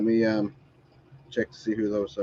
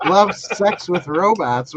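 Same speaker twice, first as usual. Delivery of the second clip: laughing; 8 semitones higher, 6 dB louder, slower than usual. Real, not acted. Mocking the show title, laughing.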